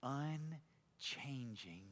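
soft, slow, low devotional reading; quiet intimate reflection. Acted. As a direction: share a private prayer slowly and softly.

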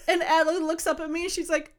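usual speaking voice

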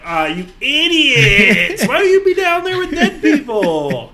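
high, loud yelling at the screen; mock yell. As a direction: shout at them, laughing.

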